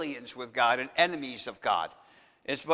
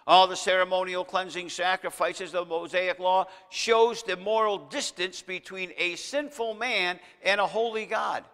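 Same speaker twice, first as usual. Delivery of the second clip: raised, higher preaching voice pressing the point; fiery preaching; 6 semitones higher, 3 dB louder than usual. Acted. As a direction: preach it hard to the congregation. Preacher builds to full force.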